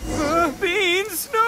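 panicked yelp. Acted. Bean allergy reaction, panicked.

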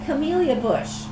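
ordinary delivery

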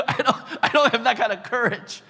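high laughing confession. Real, not acted. Preacher laughing that he lacks courage.

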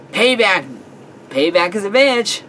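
high, loud triumphant crowing; gloating shout. Acted. Laughing at friend fallen in the lake, gloating shout. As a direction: crow it out with relish.

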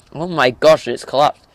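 startled as it falls in; surprised. Real, not acted. Fire structure collapses.

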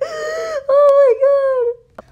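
high, squealing burst of laughter; helpless laughter. Real, not acted. Vlogger cracking up at a silly chat list, squealing laugh. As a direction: laugh it out, almost crying with it.

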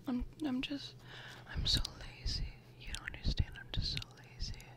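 soft breathy whisper, close to the mic; ASMR whisper. Real, not acted. Vlogger whispering through her bag contents, ASMR style. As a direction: whisper like sharing secrets with a friend.

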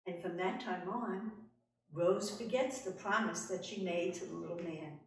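ordinary delivery, nothing marked

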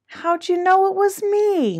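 high-pitched moan of a turkey. A narrator's reading. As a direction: moan it high, drawing out the end. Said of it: "moaned Turkey".

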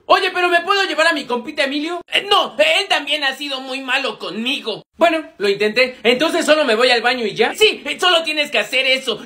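ordinary talk

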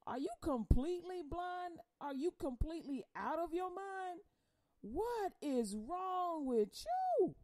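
put-on a fed-up God calling out his child; high disbelieving rebuke; God's exasperated voice. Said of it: "I keep going".

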